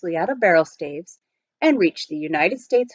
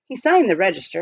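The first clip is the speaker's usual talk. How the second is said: ordinary delivery, nothing marked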